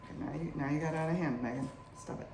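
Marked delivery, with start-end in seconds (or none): none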